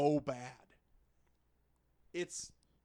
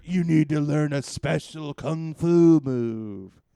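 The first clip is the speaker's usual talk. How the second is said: loud, slow solemn line; solemn game voice; 15 dB louder, slower than usual. Retold or imitated. Game tells the hero, slow and solemn. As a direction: intone it like a wise narrator.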